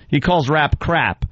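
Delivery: ordinary delivery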